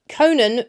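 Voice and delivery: CONAN with stress first and short second vowel; pronounced without fada. Shown as a demonstration. Teacher naming the unaccented form first, "CO-nun" Conan. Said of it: "is not Conan, it's Conan".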